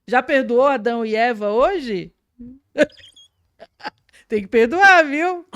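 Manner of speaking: loud, laughing jab; laughing tease. Real, not acted. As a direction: tease him through a laugh. Teasing aside to the pastor. (provocação rindo alto)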